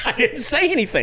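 high, laughing mock innocence; giggling I didn't say anything; laughing innocent protest. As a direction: say it through a laugh, playing innocent. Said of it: "And I go".

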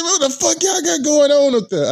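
very high, indignant imitation; high-pitched, hammed-up outrage; mock alarm. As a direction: imitate a rattled friend yelping in protest. Repeats thrown man's protest.

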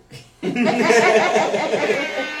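real high-pitched belly laugh; long loud laugh. Real, not acted. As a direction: crack up at the joke. Cracks up at spliff comment.

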